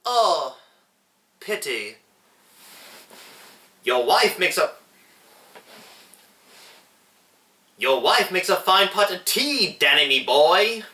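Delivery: high-pitched, put-on Irish leprechaun; hammed-up Irish lilt, pitched high; silly Irish accent. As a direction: do an amateur Irish leprechaun impression. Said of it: "silly Irish accent".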